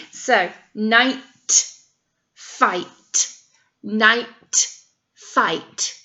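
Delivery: night and fight said slowly, each word set apart; rhyming words stressed. Shown as a demonstration. Teacher showing children that night and fight rhyme, spaced out. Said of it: "Those words rhyme".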